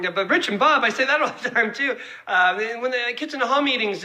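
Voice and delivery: usual speaking voice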